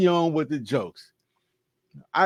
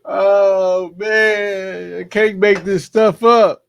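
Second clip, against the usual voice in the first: louder and higher, cracking up after a laugh; excited outburst; 6 semitones higher, 7 dB louder, much slower than usual. Real, not acted. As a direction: burst out in amused disbelief. Bursts out after reading post.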